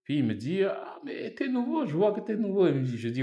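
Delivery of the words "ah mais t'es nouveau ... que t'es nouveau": imitated friend, pitched way up; excited friendly greeting. Student from Niger spotting a newcomer, high and excited. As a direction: act out high-voiced surprise at meeting someone new.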